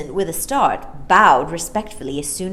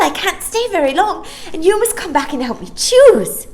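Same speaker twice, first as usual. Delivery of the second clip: put-on high, loud, bubbly little girl; excited child; 8 semitones higher, 6 dB louder than usual. Maya to the children, high excited little girl. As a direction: chatter on in a happy rush.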